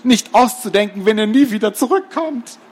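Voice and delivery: high-pitched, aghast headmaster; horrified. A narrator's reading. Headmaster imagines children never returning, horrified. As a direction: fret high in horror. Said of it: "schlug entsetzt eine Hand".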